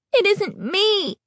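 anguished high slow denial; high slow wail. A narrator's reading. Girl pressing masked cheeks, high anguished wail. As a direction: wail it in despair. Horror at reflection.

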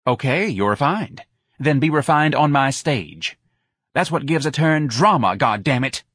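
exasperated instruction; bossy club owner. Acted. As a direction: lecture the dancer impatiently.

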